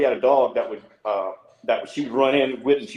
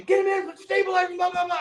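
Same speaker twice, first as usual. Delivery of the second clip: much higher retold shout of hospital orders; urgent staff shouting. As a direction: bark the orders like a panicked ER team. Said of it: "all these people come rushing".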